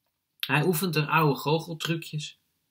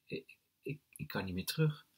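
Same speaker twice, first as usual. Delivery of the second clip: hesitant; 2 semitones higher, 8 dB quieter than usual. A narrator's reading. Narrator hesitates, unable to back out.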